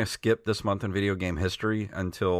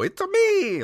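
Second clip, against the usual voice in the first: much higher, cartoonish Italian plumber catchphrase; Mario impression. Host mimics Mario to show what Pratt skips, high Mario voice. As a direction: pop up into a squeaky Mario voice. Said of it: "He's not doing, you know".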